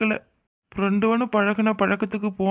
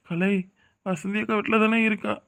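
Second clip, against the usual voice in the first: ordinary talk, nothing marked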